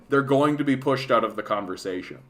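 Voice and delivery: normal talking voice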